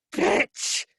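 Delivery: high-pitched scream of pain; screamed insult. A narrator's reading. Fergus screams as the girl bites his ankle, high scream. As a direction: scream it high, narrating a bitten man. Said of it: "Fergus screamed".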